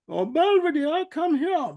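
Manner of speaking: high, loud sing-song call to a dog; voices. Retold or imitated. Disguised dog calling sweetly to lure Belvedere, high and loud. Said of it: "I didn't mean to do the voices".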